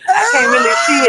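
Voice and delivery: shrieking in disgust. Real, not acted. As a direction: squeal at the gross sight. Squealing while watching worms.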